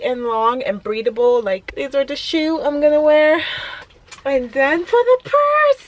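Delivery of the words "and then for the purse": excited. Real, not acted. Presenting the purse.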